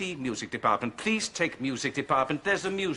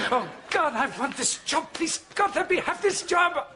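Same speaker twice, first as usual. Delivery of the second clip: much higher, pleading to God in a frantic rush; desperate prayer; 14 semitones higher than usual. Acted. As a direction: beg heaven for the job, voice climbing.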